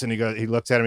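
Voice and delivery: ordinary talk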